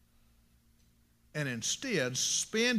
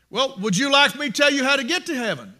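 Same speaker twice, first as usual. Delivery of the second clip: high, loud acted offer; loud witnessing; 7 semitones higher, 8 dB louder than usual. Preacher offering to tell a stranger about heaven, loud question.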